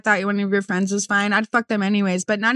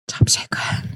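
whisper; slower than usual. Acted. Hushed teasing question.

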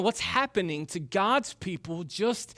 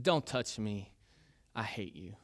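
low, much softer, bitter words pulled away; cold hurtful retort; 7 semitones lower, 7 dB quieter than usual. Retold or imitated. Boy pulling his arm from his mom, low and bitter. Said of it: "those three words".

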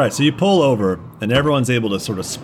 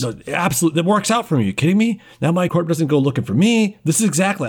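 high, quick in-character agreement; eager pleading captive; 5 semitones higher, faster than usual. Acted. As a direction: gush agreement to save your skin. Captive eagerly agrees to fake death.

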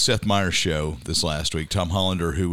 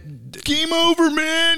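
much higher, slow panicked wail; movie quote impression; 3 dB louder, much slower than usual.

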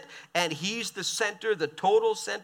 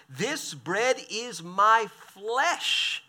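very slow, every word laid down; slow, weighty reveal; 3 semitones higher, much slower than usual. Acted. Preacher springing Jesus' shocking claim on the crowd, very slow. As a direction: drag out "This bread is my flesh" deliberately. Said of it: "Watch this".

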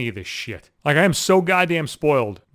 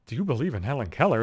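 a quieter, stunned repeat of the question; an incredulous murmur, rising at the end; disbelieving echo; 5 semitones lower, 5 dB quieter than usual. Retold or imitated. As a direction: say it back to yourself in disbelief, under your breath. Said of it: "I'm not paying attention".

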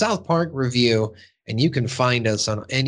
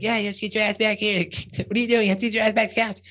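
high, bossy cartoon kid; high mock Cartman demands; 8 semitones higher, much faster than usual. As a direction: boss him around like Cartman. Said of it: "And Cartman's like".

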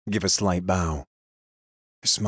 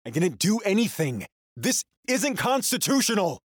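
high, desperate shouted denial; panicked protest; 12 semitones higher than usual. Acted. The prisoner shouting his innocence at the controller.

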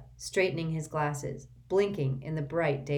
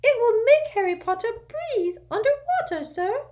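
much higher, louder squeaky elf voice; urgent little servant squeaking; an anxious house elf waking his master; 7 dB louder than usual. A narrator's reading. Dobby voiced.

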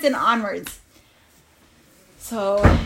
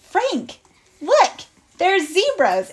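high, loud call of discovery; excited; 8 semitones higher, 6 dB louder, slower than usual. Acted. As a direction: urge a friend to look, thrilled. Excited discovery told to Frank.

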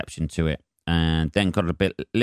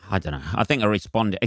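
ordinary delivery

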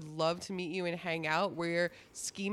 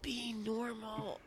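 motherly scold; 9 dB quieter, slower than usual. Acted. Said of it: "You sound like my mom".

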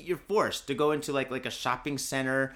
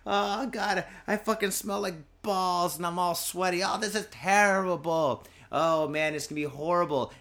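mock whining; 5 semitones higher than usual. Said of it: "and you're like".

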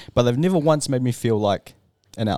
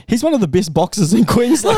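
a hint of a teammate's grave warning, a bit louder; 10 semitones higher, 5 dB louder than usual. Teammate warning him about the boxer, slightly raised. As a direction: deliver the punchline warning. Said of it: "And he goes".

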